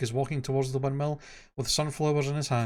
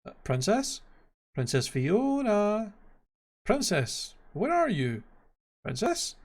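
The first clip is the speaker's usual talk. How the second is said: high, questioning call for the princess; Donkey calling into an empty room; searching call; 6 semitones higher, slower than usual. A narrator's reading. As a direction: call out tentatively, drawing out the name.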